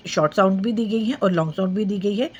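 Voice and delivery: ordinary talk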